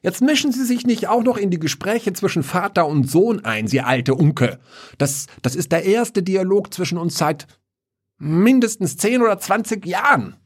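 put-on dead father yelling at the old woman; bellowing. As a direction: roar at the meddling neighbour. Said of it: "brüllte Pauls Vater".

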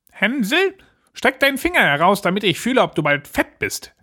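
high-pitched witch, demanding; old witch calling. Acted. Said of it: "schlich die Alte zu dem Ställchen und rief". (fordernd rufende alte Hexe)

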